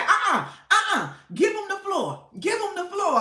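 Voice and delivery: high, playful voice for the host; host telling chat to let the troll talk; imitating the host. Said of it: "She said".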